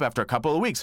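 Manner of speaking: ordinary talk, nothing marked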